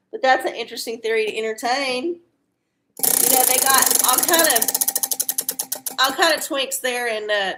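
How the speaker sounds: usual speaking voice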